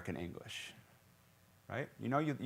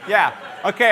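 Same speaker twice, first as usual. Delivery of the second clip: mock clueless student; 8 semitones higher, 13 dB louder than usual. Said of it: "Dude, it's like".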